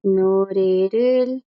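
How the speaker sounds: "Norerul" drawn out very slowly and evenly; teacher repeats "Norerul" slowly for students; slow Korean demo. Shown as a demonstration. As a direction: lay out each syllable of "Norerul". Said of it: "Yo puedo usar la palabra canción".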